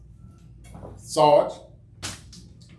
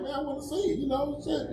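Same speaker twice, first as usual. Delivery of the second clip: high pleading; 11 semitones higher, 7 dB quieter than usual. Retold or imitated. Himself pleading softly.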